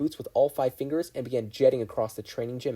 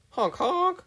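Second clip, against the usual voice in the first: very high comic honk; silly honk; 5 dB louder than usual. Acted. The fireworks truck answering, silly honk. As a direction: honk like a toy horn.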